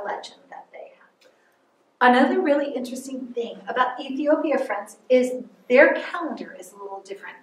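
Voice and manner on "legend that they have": whisper. Real, not acted. Spooky legend aside.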